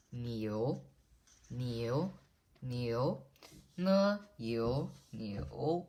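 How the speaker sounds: "niu" gliding upward at the end; rising second tone. Shown as a demonstration. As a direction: say "niu" slowly with a rising pitch, breaking it into "ne, yu". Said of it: "Niu is the rising tone".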